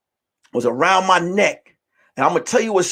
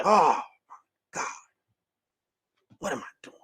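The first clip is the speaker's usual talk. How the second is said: whisper; 7 semitones lower, 9 dB quieter than usual. Retold or imitated. Panicking in the bathroom.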